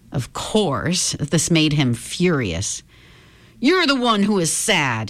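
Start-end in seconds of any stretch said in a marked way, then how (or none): 3.6-5.1 yelled. Acted. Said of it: "he yelled".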